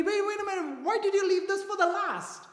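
mock astonishment at the wedding feast; surprised master of ceremonies. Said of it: "The master of ceremony".

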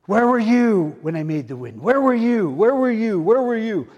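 loud, high, thundering questions; God demanding where were you from the storm; booming voice of God. Acted. Preacher voicing God's booming challenge.

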